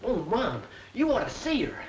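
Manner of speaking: breathless high excitement; excited. Acted. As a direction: gush it to mom. Boy excited about the girl.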